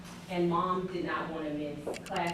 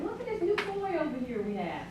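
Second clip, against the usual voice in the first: cheery distraction; 11 semitones higher than usual. Retold or imitated. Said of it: "And like".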